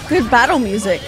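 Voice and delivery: usual speaking voice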